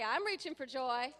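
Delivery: loud cheerful call; loud upbeat affirmation; enthusiastic declaration. Shown as a demonstration. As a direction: declare it to the room. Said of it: "High five the person next to you and say".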